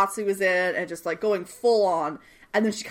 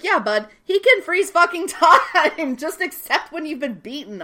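high, loud scolding with a laugh inside; mock exasperation; 7 semitones higher, 5 dB louder than usual. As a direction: tell him off, pitched up and incredulous. Exasperated imagined reply to a character.

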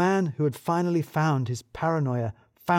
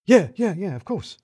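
flustered yes; 6 semitones higher, 4 dB louder than usual. Acted. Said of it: "boss panics before dashing off".

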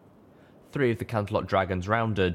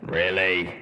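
rumbling; 3 dB louder than usual. Acted. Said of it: "Steelhooves rumbled".

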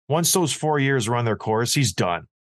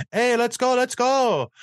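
put-on much higher, cheery French-Canadian accent on let's go; mock French-Canadian accent; 10 semitones higher, slower than usual. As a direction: imitate Fleury's sweet French-Canadian accent. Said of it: "coming in with that sweet accent".

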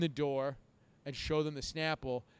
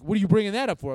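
loud, higher incredulous question; acted exasperated cop; 5 semitones higher, 13 dB louder, faster than usual. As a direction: imitate a cop thrown by the remark. Retelling the cops' exasperated reply.

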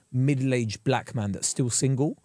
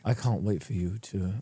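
slow, low mock-seductive line; slow Bridgerton-style lover's line; said slowly; 6 semitones lower, 5 dB quieter, slower than usual. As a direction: draw it out slowly, softer and lower. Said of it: "He said slowly".

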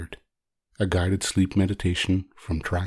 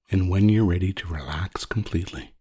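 guided sleep meditation; 5 dB quieter than usual. Acted. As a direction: lull the listener gently toward sleep, unhurried.

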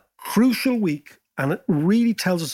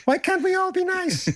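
lightly voiced peacemaker, pitched way up; mock naive high voice; 11 semitones higher than usual. Mimicking the elder of the commune, high and wistful. Elder Lemon's imagined thought.

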